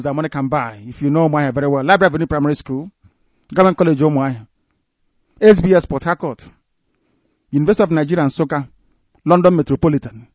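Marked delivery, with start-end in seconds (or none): none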